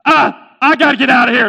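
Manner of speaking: high, loud comic panic; freaking out. Acted. Merchant fleeing a talking camel, freaking out. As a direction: blurt it loudly in mock panic. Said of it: "Mister Abdullah was freaking out".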